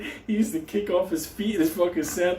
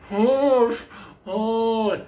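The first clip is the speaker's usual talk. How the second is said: high pained "oh, oh" moan; pained groan; 6 semitones higher than usual. Retold or imitated. Patient moaning to his friends in pain, high pained moan. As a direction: moan like a man with a rotten tooth.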